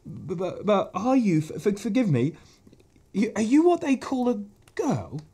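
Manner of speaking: high, stuttering nervous fawn voice; a shy creature tripping over his words; stammering fawn. Acted. Fawn nervously greeting a girl, high stammer.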